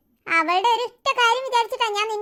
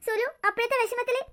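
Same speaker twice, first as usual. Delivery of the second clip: ordinary talk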